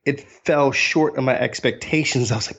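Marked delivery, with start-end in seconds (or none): none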